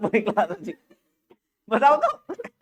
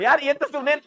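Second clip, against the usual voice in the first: usual speaking voice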